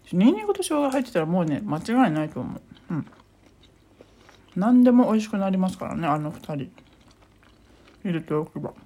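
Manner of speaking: talking while chewing; words round a bite of gyoza; mouth full. Real, not acted. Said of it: "ごめんなさいね。普段は食べている最中、喋らない。". (口に物を入れたまま)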